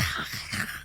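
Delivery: low-volume voiceless animalistic snarl; imitated animal growl. Narrator imitating killer growling like a wolf, animalistic growl. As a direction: mimic a growling wolf, all breath. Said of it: "I was growling and making animalistic noises".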